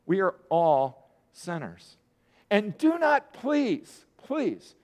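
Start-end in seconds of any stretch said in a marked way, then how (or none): 2.5-4.8 urgent plea. Real, not acted. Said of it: "I have to say this before I go".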